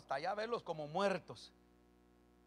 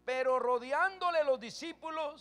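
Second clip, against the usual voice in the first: usual speaking voice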